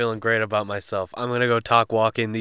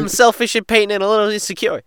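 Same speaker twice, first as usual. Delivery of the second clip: high, loud mock-gangster voice; annoying rookie mobster; 12 semitones higher, 8 dB louder, faster than usual. Showing how he talks, as annoying mafia rookie. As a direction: redo the quote as the pitched-up annoying rookie. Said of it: "This is how he talks".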